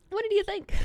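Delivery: squeaky high-pitched question; high playful voice. Acted. Playful high question, far timbre.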